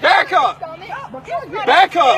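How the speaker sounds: loud commands to back off; shouting. Real, not acted. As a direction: bark it out, angry and urgent. Bystander yelling at crowd.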